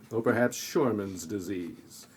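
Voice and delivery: ordinary talk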